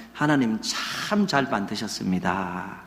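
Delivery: slow, low, soft Seoul standard; polished Seoul accent, drawn out softly; standard Seoul speech. Shown as a demonstration. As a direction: demonstrate smooth, refined Seoul speech. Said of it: "서울 표준말로 하면은".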